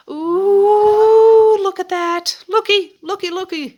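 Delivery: thrilled "looky, looky" singsong; excited. Real, not acted. Excited showing the punch trays.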